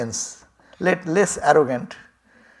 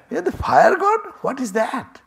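much higher, teasing disbelief; mock surprise; 11 semitones higher, 4 dB louder than usual. Stranger teases the fire god, mock surprised and high. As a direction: feign amazement at his boast.

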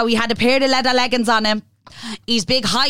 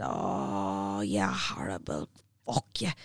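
much lower, softer, slow dread; mortified groan; 14 semitones lower, 13 dB quieter, much slower than usual. Retold or imitated. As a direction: sink into embarrassment. Groaning at the message request.

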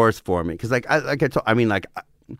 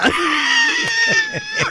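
high-pitched real laughing fit; cracking up. Real, not acted. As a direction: burst out laughing. Cracking up at the joke.

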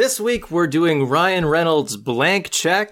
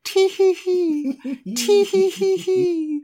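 high slow tee hee giggle; mock giggle; slower than usual. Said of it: "laugh like this".

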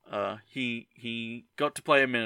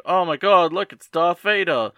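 higher, louder mock amazement; joking mock exclamation; 7 semitones higher, 9 dB louder than usual. Host repeats an earlier joke about the Minotaur, mock-amazed. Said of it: "I jokingly said".

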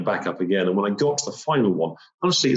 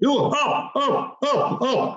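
much higher, frantic cries; alarmed Sherpa; much slower than usual. Retold or imitated. Sherpa finds the climber passing out and cries out in alarm. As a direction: wake him up in alarm. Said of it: "he's like".

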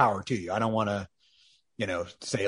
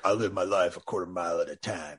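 low, gravelly-free lowered quote voice; gruff Dom impression; 6 semitones lower, 6 dB quieter than usual. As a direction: drop low like a tough guy. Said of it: "what does that even mean".

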